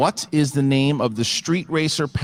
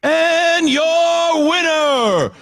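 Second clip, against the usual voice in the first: drawn-out ring announcer shout; 5 dB louder, much slower than usual. Real, not acted. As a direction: stretch the name out, boxing style. Announcing the winner.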